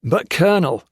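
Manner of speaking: officer losing patience with a raving colonel; exasperated. A narrator's reading. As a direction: bark it, fed up. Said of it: "at the end of his patience".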